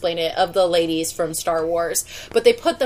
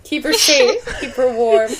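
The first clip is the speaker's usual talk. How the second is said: dramatic movie quote; 6 semitones higher, 5 dB louder than usual. Retold or imitated. Echoing a film line.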